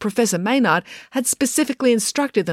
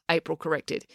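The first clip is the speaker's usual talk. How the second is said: usual speaking voice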